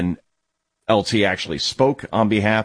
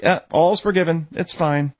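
mock shrug; 8 semitones higher than usual.

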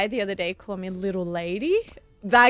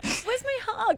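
put-on high, soft baby-talk plea; 13 semitones higher, 6 dB quieter, slower than usual. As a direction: whine it like a small child. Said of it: "And I've done it in a baby voice".

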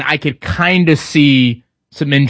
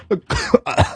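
sudden sharp cough; 9 semitones higher, 4 dB quieter than usual. Real, not acted. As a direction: cough mid-sentence. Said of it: "I'm getting choked up".

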